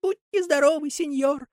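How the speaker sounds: high, polite little voice. Acted. Buratino politely blessing Karabas's sneeze. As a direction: say it sweetly and courteously. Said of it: "Буратино вежливо".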